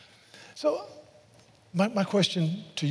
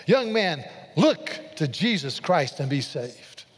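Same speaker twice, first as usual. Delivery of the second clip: normal talking voice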